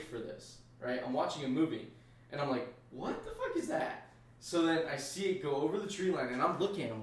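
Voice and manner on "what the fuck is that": shocked squeak. Retold or imitated. Retold shock at seeing orbs.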